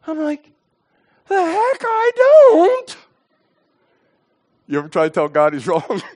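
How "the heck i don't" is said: high, drawn-out protest; indignant retort. Retold or imitated. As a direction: stretch it out in high-pitched disbelief. Indignant retort to God, acted.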